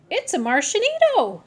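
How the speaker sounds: high, loud and thrilled; squealing announcement; excited cry. Acted. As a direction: shout the discovery with glee.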